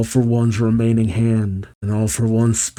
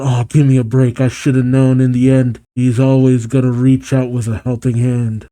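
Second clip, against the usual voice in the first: plain reading voice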